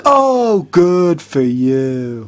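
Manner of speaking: mock praise, stretched out slowly; sarcastic congratulations. Mocking the imagined booker.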